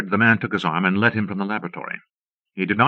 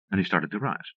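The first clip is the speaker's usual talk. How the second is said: ordinary delivery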